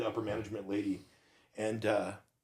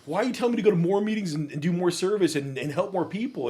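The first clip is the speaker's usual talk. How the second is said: exasperated inner rant; 9 semitones higher, 9 dB louder than usual. Retold or imitated. Retold inner rant about sponsor.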